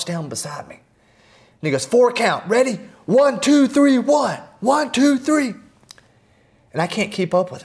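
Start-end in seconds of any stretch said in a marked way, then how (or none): 1.9-5.5 high, loud barked count; drill sergeant count. Retold or imitated. Old drill sergeant counting push-ups beside him, high and loud.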